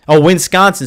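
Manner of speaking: oh, catching the slip; a sudden loud correction. Real, not acted. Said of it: "Sorry, Greg. Sorry, Wisconsin".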